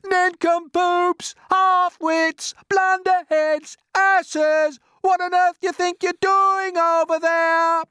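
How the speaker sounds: high, loud string of insults; drawn-out, high-pitched yelling. A narrator's reading. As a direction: holler abuse across the distance. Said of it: "he yelled".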